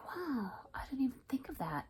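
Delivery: awed, breathy whisper; reacting to her sister's blanket idea; amazed whisper. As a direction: marvel at it under your breath. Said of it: "I was like".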